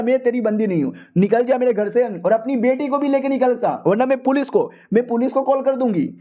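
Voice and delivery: mock furious wife; angry wife. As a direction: threaten him, pitched high. Wife threatens police.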